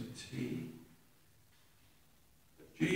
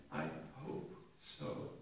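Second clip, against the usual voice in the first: doubtful hope; 11 semitones lower, 11 dB quieter, slower than usual. Retold or imitated. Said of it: "it's linked to uncertainty".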